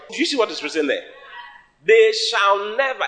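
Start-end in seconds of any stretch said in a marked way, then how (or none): 1.8-3.0 high, slow, insistent declaration; emphatic proclamation. Real, not acted. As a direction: stretch "never" with raised pitch. Repeats the promise for emphasis.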